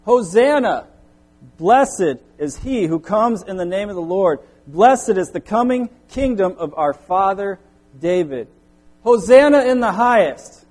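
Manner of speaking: high, loud cry of acclamation; crowd shouting. Acted. Preacher voicing the crowd at the triumphal entry, shouting. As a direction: shout it out like a crowd hailing a king. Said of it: "those who followed were shouting".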